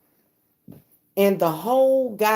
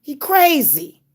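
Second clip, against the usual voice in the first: high mocking exclaim; 9 semitones higher, slower than usual. Real, not acted. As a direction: squeal it out in disbelief. Sudden high exclaim about father.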